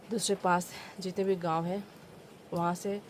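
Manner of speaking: ordinary talk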